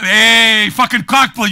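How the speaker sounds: shouting out a chatter's name, high and loud; excited holler at a viewer; loud call-out. Real, not acted. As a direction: call him out loudly across the room. Streamer calls out to chatter.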